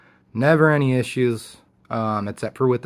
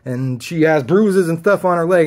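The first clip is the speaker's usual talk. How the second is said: mock angry accusation; 6 semitones higher, 4 dB louder than usual. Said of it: "He's like".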